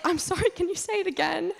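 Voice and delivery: high, nervous apology, pitched well up; flustered, asking a third time; embarrassed apology. Retold or imitated. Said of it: "I was like".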